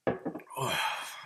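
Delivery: long quiet sigh; unhurried, hushed exhale. Real, not acted. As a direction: breathe out a soft, weary sigh. Pause between stories.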